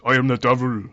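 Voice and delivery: a mock Welsh accent, lightly done; bad Welsh accent. Podcast host imitating Anthony Hopkins, bad Welsh accent. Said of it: "That's a terrible Welsh accent".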